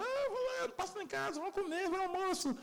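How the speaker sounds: high, eager mock invitation; chirpy friendly inviter, way up high; husband inviting everyone. Man eagerly inviting neighbours over to eat, high and chirpy. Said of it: "ninguém podia ver o marido dela que falava".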